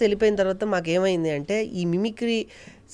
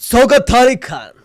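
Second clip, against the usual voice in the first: loud, high-pitched film hero punch dialogue; Chiranjeevi; 5 semitones higher, 8 dB louder than usual. Shown as a demonstration. Hero warning his enemy about his power, loud menacing declamation. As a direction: thunder it like a mass hero, each phrase punched out. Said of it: "ఇంకొక voice Chiranjeevi గారు చేస్తే".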